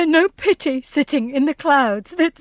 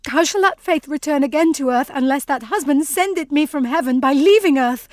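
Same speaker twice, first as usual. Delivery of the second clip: normal talking voice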